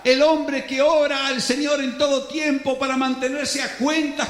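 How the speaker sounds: impassioned pulpit preaching; fiery preaching. Acted. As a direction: preach it with building fervor. Preaching at full force.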